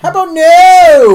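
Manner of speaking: emphatic refusal. Real, not acted. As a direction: shriek it out, no way. Refusing ghost hunt.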